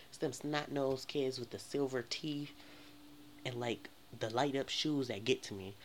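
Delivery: usual speaking voice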